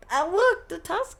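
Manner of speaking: high, loud delighted cry; light up at the sight; excited. Real, not acted. Spots a favourite Pokemon.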